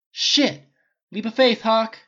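calling out. A narrator's reading. Tony telling the trapped archer to jump, urgent shout. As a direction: yell it to the archer mid-flight. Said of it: "Tony called".

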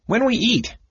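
gully dwarf voice. Acted. Bupu the gully dwarf asks.

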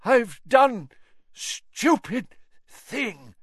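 high, slow breathy confession; each word costs effort; breathed. Acted. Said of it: "Mister Crouch breathed".

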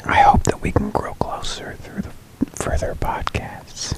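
hushed sultry whisper; ASMR whisper. Pretend. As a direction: breathe the words softly. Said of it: "a little A S M R".

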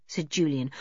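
usual speaking voice

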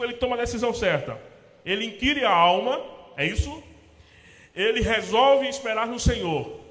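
high, loud pulpit preaching; full-force preaching. Acted. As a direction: preach it out to the congregation. (pregação inflamada, alta e forte)

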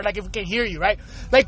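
usual speaking voice

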